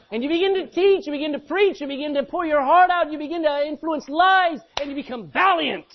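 high, loud rising preacher build; fiery pulpit build; full-force preaching. Real, not acted. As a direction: lift the room with each line. Preaching crescendo.

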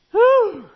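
exaggerated gasp of amazement; loud high whistle-like whew. Real, not acted. Pastor reacting to an eighty-five thousand salary with a loud high whew. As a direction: react as if the sum is huge.

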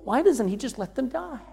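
ordinary delivery

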